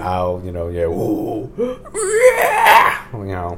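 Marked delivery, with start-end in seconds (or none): 1.6-3.1 excited gasp. Real, not acted. Excited whoop during chat.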